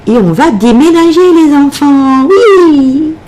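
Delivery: drawn-out, rising sing-song cheer; annonce enjouée. Pretend. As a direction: announce it to the kids, climbing into a long high "oui". Annonce joyeuse du déménagement aux enfants. (annonce chantante et enjouée, qui monte)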